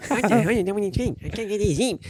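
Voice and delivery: put-on high, quick slurred drunk complaint; drunk guy voice. Drunk guy at the wrong tent, high slurred complaint. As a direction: sound hammered and confused.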